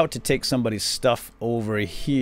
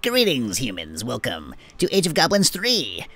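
goblin voice; 2 semitones higher than usual. Acted. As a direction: greet the humans like a mischievous goblin. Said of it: "I won't be doing Echo's voice very often".

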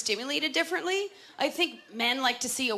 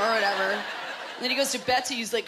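ordinary talk

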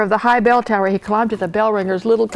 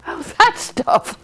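high, slow, laughing dismissal; 13 semitones higher, slower than usual. A narrator's reading. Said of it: "laughed the captain".

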